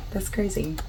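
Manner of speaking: usual speaking voice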